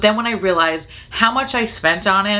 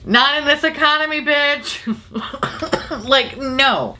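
sassy refusal; 8 semitones higher than usual. Real, not acted. Rejecting price.

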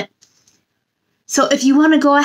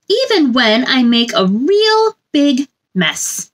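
gentle sing-song picture book reading; storybook read-aloud; 3 semitones higher than usual. A narrator's reading. Reading a picture book to children, sing-song storybook voice. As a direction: read it like bedtime story time for little ones.

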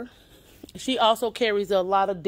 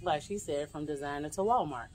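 kind of whispering; 11 dB quieter than usual. Real, not acted. Vlogger to viewers, not disturbing other customers, half-whispering. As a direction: keep it down so others aren't disturbed. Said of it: "I'm kinda whispering".

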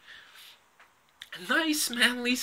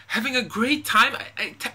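ordinary talk

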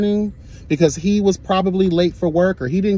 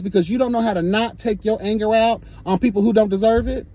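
angry rant; 6 semitones higher than usual. Real, not acted. Ranting at the rude trucker.

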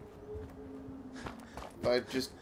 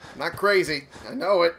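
frustrated; 4 semitones higher, 8 dB louder than usual. Real, not acted. Frustrated at not finding location.

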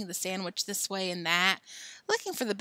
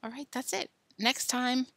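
ordinary delivery, nothing marked

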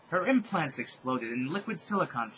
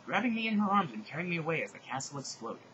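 ordinary delivery

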